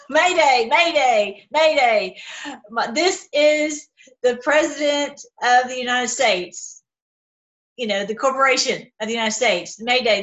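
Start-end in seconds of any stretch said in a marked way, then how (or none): 0.1-2.2 loud, urgent distress call; mock presidential mayday call. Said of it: "I'm gonna pretend to be the president". 2.7-6.8 slow, solemn president voice; mock presidential address. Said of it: "I'm gonna pretend to be the president".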